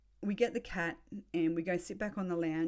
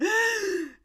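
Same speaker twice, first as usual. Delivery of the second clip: loud, high, drawn-out gasp; re-enacted gasp of shock; horrified gasp, acted; 10 dB louder than usual. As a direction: suck in a big shocked breath. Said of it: "and I've just gone".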